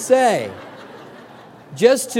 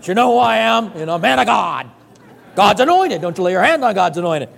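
mock indignant preacher; 4 dB louder than usual. Pastor mocking a proud preacher, pompous outrage. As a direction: play the offended man of God. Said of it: "People think I'm gonna be upset".

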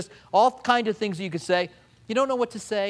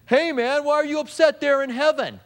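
mocking tactless visitor; 9 semitones higher, 4 dB louder than usual.

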